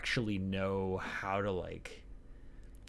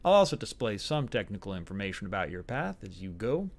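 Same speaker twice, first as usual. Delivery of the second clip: hammed-up American Southern accent; awful Southern accent. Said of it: "awful Southern accent".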